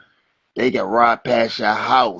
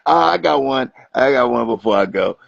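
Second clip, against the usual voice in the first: usual speaking voice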